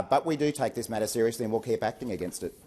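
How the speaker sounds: ordinary delivery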